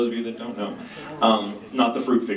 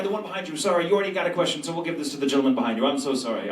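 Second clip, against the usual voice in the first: ordinary talk, nothing marked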